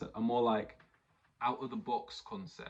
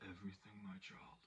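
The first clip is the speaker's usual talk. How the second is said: much softer, whispered reply; dramatic whisper; 17 dB quieter than usual. Retold or imitated. Thanos answering his daughter Gamora, grave whisper. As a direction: breathe it like a grave villain. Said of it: "And he's like".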